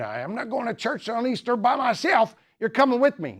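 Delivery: high-pitched British wife, hammed up; British accent. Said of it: "that's my best British accent".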